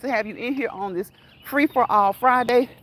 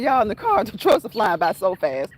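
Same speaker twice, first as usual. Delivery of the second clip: normal talking voice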